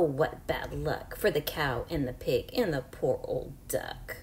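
usual speaking voice

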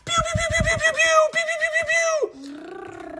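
high, rapid pew pew pew; a kid's sky-high laser blasts; laser pew sounds. Pretend. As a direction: fire off toy laser zaps way up high. Laser shooting in toy play.

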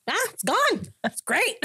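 high-pitched, gleeful mock surprise; acted-out happy discovery; delighted relief. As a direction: toss it up high, heading into a laugh. Acts out relief at lost feelings.

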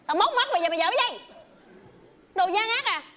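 loud, high furious threat; mock angry shout. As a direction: threaten to gouge eyes, yelling. Outburst at greedy partner. (quát tháo giận dữ)

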